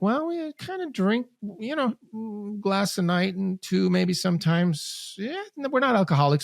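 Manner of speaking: sheepish denial, voiced well above own pitch; mock evasive American. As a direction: play the couple squirming out of admitting it.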